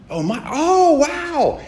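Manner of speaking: very high, drawn-out starstruck exclamation; imitating someone hugely impressed; mock awe. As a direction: gush slowly in a high put-on voice. Mimics people impressed by his dad.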